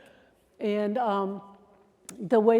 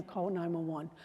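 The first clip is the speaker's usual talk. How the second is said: low, much softer instruction; calm aside; 4 semitones lower, 8 dB quieter than usual. Shown as a demonstration. Trainer showing a quiet aside to a coworker, low and calm. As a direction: say it quietly to your partner. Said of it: "continue that voice that's calm".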